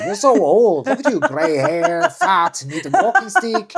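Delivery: high, loud teasing through laughter; mock the old man while laughing; high laughing taunt; laughing mock insult. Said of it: "But it's like".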